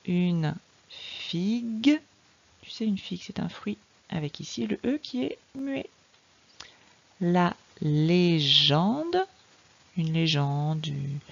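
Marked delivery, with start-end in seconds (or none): 0.1-2.0 very slow reading of "Une figue", rising at the end; deliberate, drawn-out phonics reading; slow sounding-out. Shown as a demonstration. Teacher models word for reading. 7.2-9.3 very slow "La légende", syllable by syllable, rising at the end; deliberate, drawn-out phonics reading; slow sounding-out. Shown as a demonstration. Teacher models word for reading.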